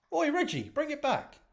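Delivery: high, loud shout after the drone; a cheeky loud call to a mate. Acted. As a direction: yell across the street to get it back. Cookie calls after flying drone.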